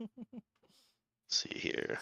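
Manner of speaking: whispered to self; whispering. Real, not acted. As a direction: murmur it under your breath. Player muses quietly.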